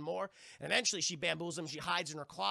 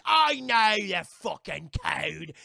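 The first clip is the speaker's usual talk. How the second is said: much louder, slow irritated pirate voice; angry growl; 11 dB louder, much slower than usual. Retold or imitated. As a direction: bite off every word, fed up. Annoyed pirate snaps back.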